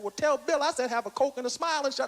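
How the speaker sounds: fast, dismissive impersonated punchline; Richard Pryor. Acted. Pryor sending a message to Cosby, quick dismissive impersonation. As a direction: rattle off the comeback. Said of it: "He said".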